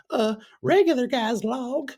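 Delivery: very high mocking character voice; high silly voice. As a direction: squeak it out like a goofy sidekick. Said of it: "And he's like".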